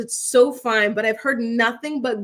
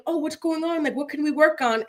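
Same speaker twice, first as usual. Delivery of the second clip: imitated concerned wives; 5 semitones higher than usual. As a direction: lightly play a worried wife coaxing. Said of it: "the ladies will be like".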